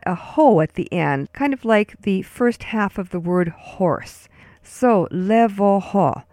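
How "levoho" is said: "levoho" drawn out slowly as LEH-vo-ho; slow syllable demo. Shown as a demonstration. Teacher models the rest of the word, "levoho" slow as LEH-vo-ho. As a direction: sound out "levoho" like level without the L, plus ho. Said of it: "take the word level and just drop the L and add".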